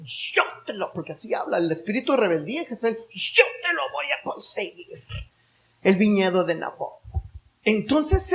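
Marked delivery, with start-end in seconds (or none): 0.0-0.9 scheming tone. Shown as a demonstration. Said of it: "póngale el tonito ahí". 3.1-6.9 scheming tone. Shown as a demonstration. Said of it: "póngale el tonito ahí".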